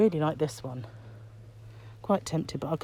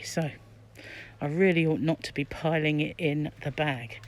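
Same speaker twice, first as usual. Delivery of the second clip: ordinary talk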